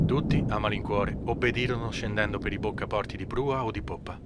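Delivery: usual speaking voice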